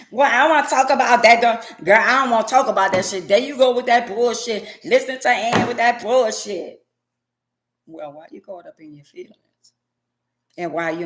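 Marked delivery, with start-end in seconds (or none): 0.1-6.8 loud, fast annoyed brush-off; irritated refusal. Acted. Partner blaming the radio host, loud annoyed brush-off. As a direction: wave the question away, fed up.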